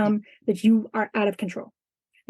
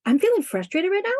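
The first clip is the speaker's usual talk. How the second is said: higher, questioning rise as it dawns on you; surprised realization; 7 semitones higher than usual. Shown as a demonstration. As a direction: catch yourself mid-thought, puzzled. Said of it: "Wait a minute".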